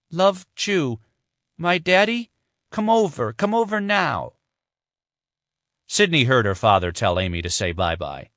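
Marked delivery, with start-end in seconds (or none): 0.1-4.3 put-on much higher little girl, pleading; a small child calling her daddy to come; toddler voice. Little Amy calling for her daddy over the phone, high pleading toddler voice.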